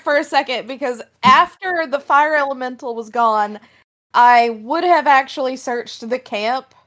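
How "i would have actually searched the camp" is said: usual speaking voice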